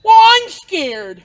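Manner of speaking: high, slow, loud frightened whine; a scared kid calls out to the babysitter. Acted. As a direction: wail it like a frightened child. Kid afraid of dark.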